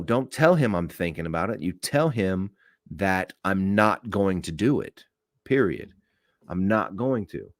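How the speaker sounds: usual speaking voice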